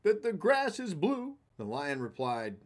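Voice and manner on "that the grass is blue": high, strained donkey squeal at the king; screaming donkey. Acted. Donkey pleading to the lion king, high hysterical squeal. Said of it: "the donkey starts screaming, like, hooting and hollering".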